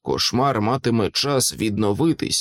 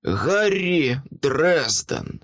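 very slow, deliberate, higher-pitched articulation; thoughtful and clear; 4 semitones higher, much slower than usual. A narrator's reading. Centurion savours the wizard's name, thoughtful and distinct. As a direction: draw out each word of the name, thoughtfully. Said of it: "сказав задумливо й чітко".

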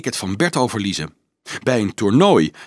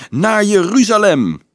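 high, loud rallying cry; crowd bellow; 9 semitones higher, 5 dB louder than usual. A narrator's reading. Children take up the monk's call, bellowing. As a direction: shout it as a fervent crowd. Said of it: "loeiden de kinderen".

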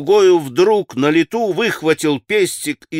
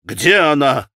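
urgent, raised-voice demand; завопил; 3 dB louder than usual. A narrator's reading. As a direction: demand an answer with a restrained yell. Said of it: "завопил Митя". (громкий требовательный окрик)

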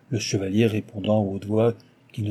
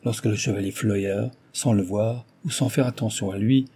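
plain reading voice

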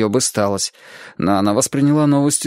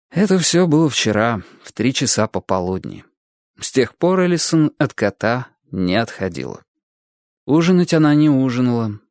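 usual speaking voice

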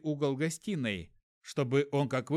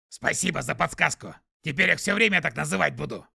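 skunk voice; 5 dB louder than usual. Acted. Скунс Пук говорит.

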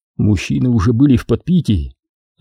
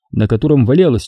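ordinary delivery

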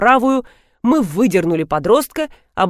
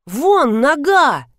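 high, drawn-out cry of alarm; startled mock yell; shouting; 6 semitones higher, 3 dB louder, much slower than usual. A narrator's reading. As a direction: cry out in shock, stretching the words. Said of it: "закричал Ефим".

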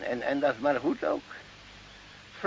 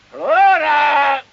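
calling out; 11 semitones higher, 12 dB louder than usual. Acted. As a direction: call loudly across the field. Calling the goat. (luid roepend naar de geit Flora)

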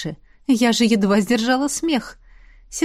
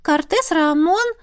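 высокий голос духа; 9 semitones higher than usual. Acted. Дух продолжает перекличку.

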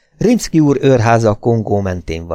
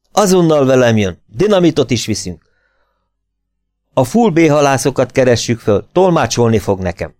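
urgent, raised-pitch orders; commanding officer; 4 semitones higher, 3 dB louder than usual. A narrator's reading. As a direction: issue orders that allow no argument. Officer orders the drunk about. (sürgető, parancsoló hangon)

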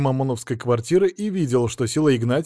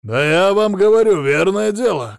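loud, higher insistent shout; 7 semitones higher, 8 dB louder than usual. A narrator's reading. Drunk husband insisting to police, shouting. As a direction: shout it to be believed. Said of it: "кричал он так".

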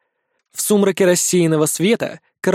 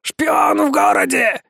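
much higher, slow alarmed shout; 9 semitones higher, slower than usual. A narrator's reading. Parrot warns Nikitka of a spy, high shout. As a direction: cry the alarm.

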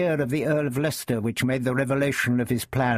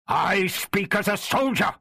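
shouted; 9 semitones higher than usual. A narrator's reading. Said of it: "shouted Leicester".

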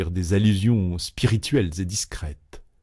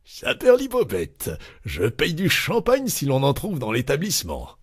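high-pitched jubilant exclamation; exclaimed cry; 7 semitones higher than usual. A narrator's reading. Loiseau offering champagne to everyone, crying out. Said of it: "Loiseau cria".

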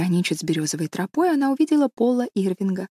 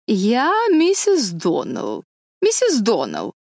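drawn-out, higher-pitched grand announcement; pompous lady visitor; 3 semitones higher, slower than usual. A narrator's reading. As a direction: announce yourself as a self-important guest. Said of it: "объявила гостья".

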